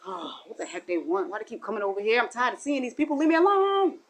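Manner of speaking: fed-up rant rising to a snap; irritated griping that boils over; annoyed grumbling to shout. Retold or imitated. Annoyed behind their backs.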